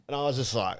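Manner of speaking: ordinary delivery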